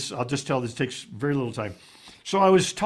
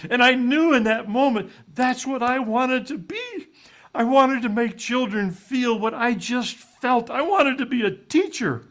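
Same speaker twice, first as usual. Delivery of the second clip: much higher and louder, fervent; retold passionate resolve; 9 semitones higher, 4 dB louder than usual. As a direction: relive the moment he found his calling. Young teacher's emotional climax retold.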